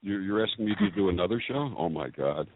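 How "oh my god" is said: usual speaking voice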